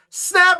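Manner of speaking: mock snap sound. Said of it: "then it goes".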